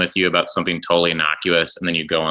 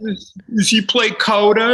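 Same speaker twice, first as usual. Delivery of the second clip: clueless fan; 14 semitones higher, slower than usual. Retold or imitated. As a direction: act the confused fan. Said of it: "Chris Pratt".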